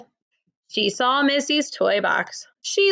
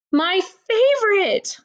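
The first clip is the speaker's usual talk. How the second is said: higher, slow pleased sigh of praise; delighted savoring; 9 semitones higher, slower than usual. Acted. Missus Lane tasting the tart, slow and higher with delight. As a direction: relish it slowly.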